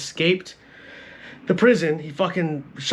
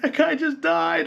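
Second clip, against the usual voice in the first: mock stunned grief; 11 semitones higher, slower than usual. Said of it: "he's like".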